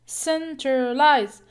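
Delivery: centralize slowed right down, loud, syllable by syllable; teacher's loud, slow demo of centralize; syllable stress demo. Shown as a demonstration. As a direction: stretch out centralize with a strong beat. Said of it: "We put the stress or the primary stress here".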